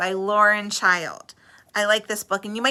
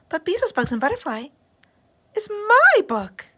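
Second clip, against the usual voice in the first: put-on indignant little girl; 11 semitones higher, 4 dB louder than usual. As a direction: insist the book is yours. Lola claiming book.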